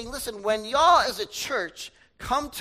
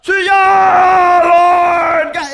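all-out shout, much higher and louder; even louder screaming prayer; 9 semitones higher, 16 dB louder, much slower than usual. Retold or imitated. Rival prayer leader out-shouting the first, all-out yell. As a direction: outdo the other guy's holler. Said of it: "the other guy was even louder".

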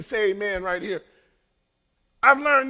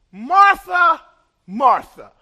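calling her name twice, first loud then dropping; Jesus calling Martha; 2 semitones higher, 8 dB louder, slower than usual. Acted. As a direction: call her name to get her attention.

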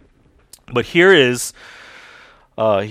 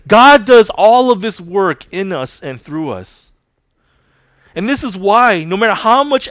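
louder, higher preaching proclamation; forceful preaching; 6 semitones higher, 9 dB louder than usual. Acted. Pastor proclaiming to the congregation, forceful preaching.

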